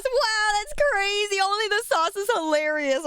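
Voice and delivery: amused disbelief. Real, not acted. Streamer laughing at a chatter throwing up only sauce, amused disbelief. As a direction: marvel at the silly detail.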